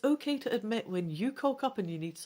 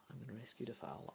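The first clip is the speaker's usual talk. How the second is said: much softer, fast repeat; a fast, quiet aside to yourself; quick mutter to self; 8 semitones lower, 16 dB quieter, faster than usual. Real, not acted. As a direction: mumble the decision under your breath. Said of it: "yeah, I, I'm gonna rescue Defal".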